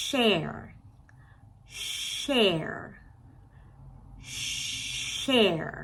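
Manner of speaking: "share" opening on a long hushing SH. Shown as a demonstration. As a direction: draw out the airy SH of "share". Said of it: "เสียง เอสเอช คือเสียงเนี้ย". (ลากเสียง sh ใน "share" เหมือนบอกให้เงียบ)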